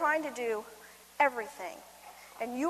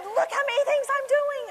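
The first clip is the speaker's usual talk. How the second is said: much higher, louder bragging; excited boast; 13 semitones higher, 6 dB louder than usual. Retold or imitated. Mom brags to her busy family, high loud boast. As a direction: show off to the family, pitched way up and loud. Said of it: "And I said".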